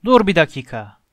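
loud, forceful halt; thundering. A narrator's reading. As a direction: boom it out like an angry giant. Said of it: "diye gürledi dev".

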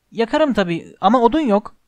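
high, loud, frantic reply; calling out. A narrator's reading. Hermione wringing her hands, calling out to Harry. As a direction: cry back in a fluster. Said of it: "diye seslendi".

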